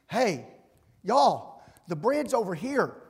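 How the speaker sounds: loud, cheerful call across a crowd. Pretend. Preacher calling out to fellow beggars, loud call. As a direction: shout it out like pointing others to food.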